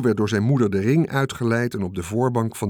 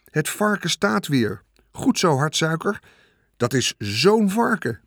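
high-pitched crowd cheers; jubilant, stretched-out exclamations; cheering; 7 semitones higher than usual. A narrator's reading. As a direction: cheer like thrilled spectators. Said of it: "juichten de toeschouwers".

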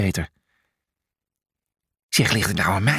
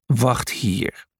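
commanding. Acted. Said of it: "sommeerde hij".

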